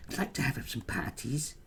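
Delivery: high, mocking put-on voice; Darren Grimes. Quoting a politics commentator on parties, mocking high voice. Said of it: "Darren Grimes ... but he says".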